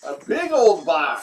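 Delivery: loud, slow excited exclaim; thrilled at the big box. Real, not acted. Excited about package.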